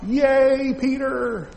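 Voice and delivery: high, drawn-out hurrah; mock cheer. Preacher cheering Peter's answer, high mock cheer.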